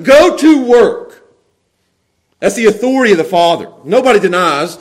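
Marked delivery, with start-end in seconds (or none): 0.0-1.3 slow, loud order; telling, not asking. Acted. Father telling his sons, slow commanding order. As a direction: hammer out the command slowly. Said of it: "he's telling them".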